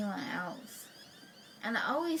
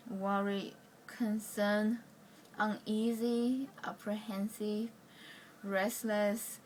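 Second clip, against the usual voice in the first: ordinary delivery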